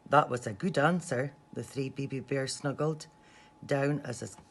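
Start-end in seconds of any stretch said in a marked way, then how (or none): none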